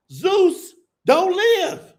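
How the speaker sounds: slow, loud and high punchline; emphatic punchline. Pretend. Comic mocking prayers to Zeus, emphatic punchline. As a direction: land each word hard.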